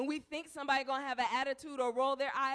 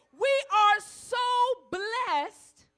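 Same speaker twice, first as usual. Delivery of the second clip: loud, slow, ringing preacher's cry; preaching cry; 4 semitones higher, 7 dB louder, slower than usual. Acted. Preacher crying out scripture to the congregation, loud and slow. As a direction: let it swell like a sermon peak.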